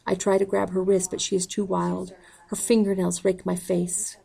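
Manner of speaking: ordinary delivery, nothing marked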